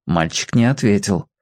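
usual speaking voice

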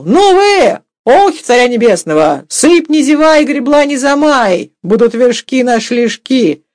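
high, loud call to the workmen; rollicking shout. A narrator's reading. Sergei calls to the men at work, dashing shout. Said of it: "молодецки кашлянул и крикнул".

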